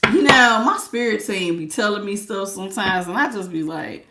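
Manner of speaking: ordinary talk, nothing marked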